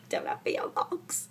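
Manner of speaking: whispered aside; whispering. Real, not acted. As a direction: drop to a secret whisper.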